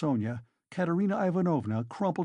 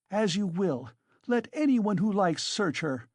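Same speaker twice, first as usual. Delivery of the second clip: defiant cry; 7 semitones higher than usual. A narrator's reading. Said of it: "cried Katerina Ivanovna".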